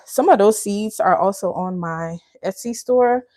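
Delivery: usual speaking voice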